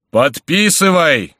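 high, loud, slowly drawn-out order; thunderous shout. A narrator's reading. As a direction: bellow the command, stretching it out. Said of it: "кричал Горанфло громовым голосом". (громовым голосом)

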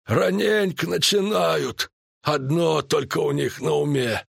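high, drawn-out druid voice; snorting disapproval. A narrator's reading. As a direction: tut at them in a high voice. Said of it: "фыркнул Мышавур". (высоким голосом, неодобрительно фыркая)

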